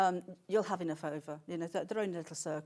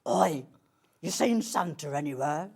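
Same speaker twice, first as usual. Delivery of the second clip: louder, gruff put-on creepy voice; spooky possessed voice; 5 semitones lower, 6 dB louder than usual. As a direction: play a menacing stranger. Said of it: "you possessed?".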